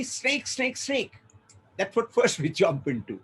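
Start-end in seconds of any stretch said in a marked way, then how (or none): 0.0-1.2 high, mock-startled cry without extra volume; acted alarm. You mistake a rope for a snake, mock alarm. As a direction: lightly act a startled warning, pitched high.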